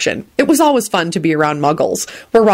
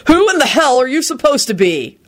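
higher, exasperated demand; furious; 7 semitones higher than usual. A narrator's reading. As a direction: demand it in outrage. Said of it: "Henry shouted furiously".

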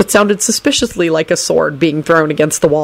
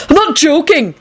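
high, insistent holler; hollered protest; 12 semitones higher than usual. A narrator's reading. Neville protests to his smiling mother, hollering. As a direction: holler it indignantly. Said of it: "Neville hollered".

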